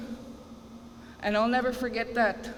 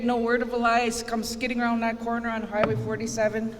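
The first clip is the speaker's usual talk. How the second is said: normal talking voice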